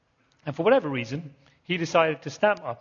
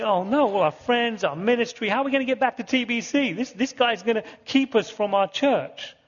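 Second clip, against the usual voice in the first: higher-pitched, hammed-up dismay; mock panic; 6 semitones higher than usual. Travellers fearing they'll miss their church, mock panic. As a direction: play up the worried thoughts. Retold worried thoughts at passport control.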